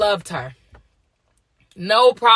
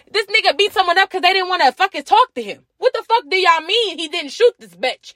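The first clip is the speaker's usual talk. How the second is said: heated rant; 11 semitones higher than usual. Real, not acted. Ranting at [name] defenders.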